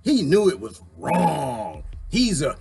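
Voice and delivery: mock Cohen testimony. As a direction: drag out the outrage in mock drama. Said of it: "So Cohen said".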